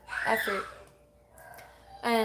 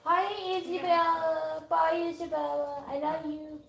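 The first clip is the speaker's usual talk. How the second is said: sing-song; 6 semitones higher than usual. Real, not acted. Greeting a child off stream.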